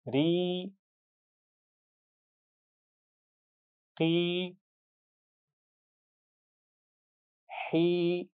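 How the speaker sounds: री, की, ही each held long and light; thin drawn-out ee. Shown as a demonstration. Teacher models long ee sounds for students: light and held. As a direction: stretch every ee, keep the r thin. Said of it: "उसको बारीक पढ़ेंगे".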